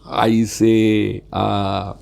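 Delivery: drawn-out, faltering "uh"s while searching for an answer; demonstrating a hesitant, evasive answer; unconfident hesitation. Shown as a demonstration. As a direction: stall and fumble as if unsure what to say. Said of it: "Not talking like this".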